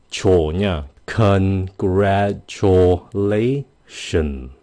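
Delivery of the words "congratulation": drawn out, dropping pitch on the last syllable; slow falling demo. Shown as a demonstration. Teacher drilling viewers, slow falling Congratulation. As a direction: say Congratulation slowly and let the tone fall at the end. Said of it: "Xuống giọng".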